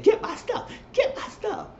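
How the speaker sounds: high squeaky mock demand; mock Nikki demanding. Nikki wanting her thrown things back, in a high mocking whine.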